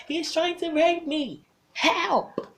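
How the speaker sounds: very high, loud cries for help; screaming for help. Retold or imitated. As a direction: yell it for your dear life. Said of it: "I yelled for my dear life".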